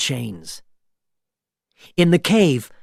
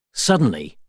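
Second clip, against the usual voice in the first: ordinary delivery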